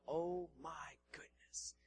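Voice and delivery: slow, breathy whisper of wonder; awestruck whisper. Acted. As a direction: whisper it slowly in awe. Acted awe at God's works.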